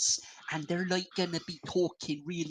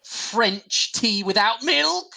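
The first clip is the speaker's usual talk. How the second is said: slow, high, loud indignant question; drawn-out horrified gasp of a question; mock outrage; 6 semitones higher, 6 dB louder, much slower than usual. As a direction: act scandalised at the very idea. Mock outrage at tea choice.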